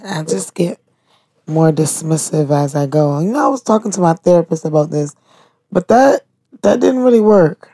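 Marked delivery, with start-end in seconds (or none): none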